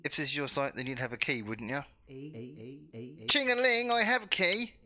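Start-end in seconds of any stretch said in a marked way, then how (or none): 3.3-4.7 high, loud sing-song taunt; triumphant sing-song brag; gloating. Real, not acted. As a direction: jingle the key in his face.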